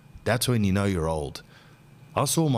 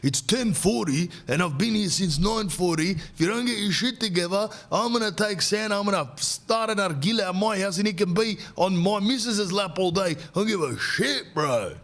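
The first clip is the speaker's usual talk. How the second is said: high put-on voice of a ranting dad; hammed-up threat, fast at the end; dad ranting at elf staff about the wait, high mock voice; angry customer; 8 semitones higher than usual. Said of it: "Someone apparently goes".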